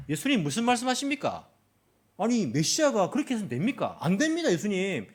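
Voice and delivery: high, loud indignant protest; angry scolding. Acted. Peter rebuking Jesus over the cross, angry scolding. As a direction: tell him off, rising to a cry. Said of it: "이렇게 막 화를 내면서 예수님을 꾸짖는 겁니다".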